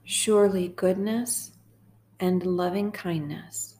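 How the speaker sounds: slow, measured psalm reading with long pauses; guided meditation. Acted. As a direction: read it line by line, unhurried, leaving room to repeat.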